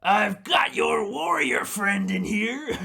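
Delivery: high, loud, slowish goblin voice with a laugh in it; goblin taunt. Acted. Goblin captor taunting the party about their friend, laughing gloat. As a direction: gloat over the hostage, laughing. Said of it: "but he says".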